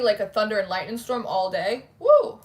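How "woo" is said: high, loud whoop of joy. Real, not acted. Streamer cheering the idea of storms. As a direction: let out a gleeful cheer.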